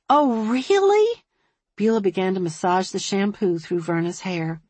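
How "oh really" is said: gasp, then high, drawn-out surprise; delighted surprise. A narrator's reading. Hairdresser hearing of a new troop, surprised. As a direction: gasp and swoop up with interest.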